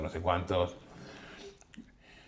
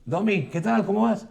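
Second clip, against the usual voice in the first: much higher, cheerful greeting; a famous singer hailing the photographer, pitched up; mock star greeting; 11 semitones higher, 5 dB louder than usual. Star greets him, voiced.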